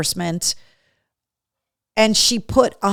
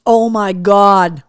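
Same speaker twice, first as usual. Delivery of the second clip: amazed; 7 dB louder, much slower than usual. Real, not acted. Reacting to caramel trick.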